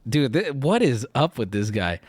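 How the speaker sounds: ordinary talk, nothing marked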